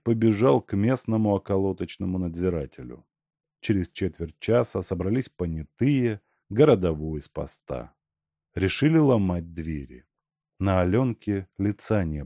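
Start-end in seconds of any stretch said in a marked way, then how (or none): none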